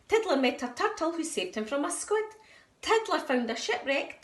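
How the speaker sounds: usual speaking voice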